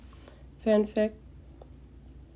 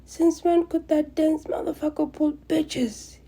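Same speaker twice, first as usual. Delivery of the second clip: higher-pitched exasperated yell; yelling from downstairs; 10 semitones higher, 4 dB louder than usual. Acted. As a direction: shout it in disbelief. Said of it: "Katsuki Bakugo yelling".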